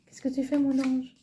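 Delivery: gentle voice for a pet; cooing to the cat. Real, not acted. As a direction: coax a curious kitten. Talking to her curious cat.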